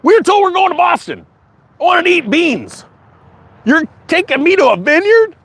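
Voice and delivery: high, fast, indignant mock migrant voice. Migrants told they land at Martha's Vineyard, high mock outrage. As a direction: squeak it out like a baffled passenger. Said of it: "Three immigrants go".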